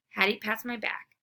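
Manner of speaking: ordinary delivery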